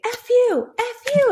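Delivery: high, loud mock cursing; mock swearing. Students told to swear to free their voice. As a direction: fling it out like a release. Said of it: "they'll say".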